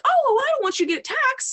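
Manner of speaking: high, hurried oh-no reassurance; concerned clients. Retold or imitated. Voicing concerned clients in retelling.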